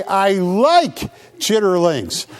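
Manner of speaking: slow, deliberate I like chitterlings; say it slowly. Shown as a demonstration. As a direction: hand them the exact line, slowly. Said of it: "you gotta say chitterlings".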